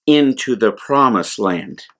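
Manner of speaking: usual speaking voice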